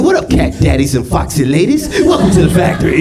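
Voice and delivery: high, funky seventies jive greeting; hammed-up seventies jive talk; jive-talking Oompa Loompa. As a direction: welcome them in jive, high and playful. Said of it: "Oompa Loompas talking in jive".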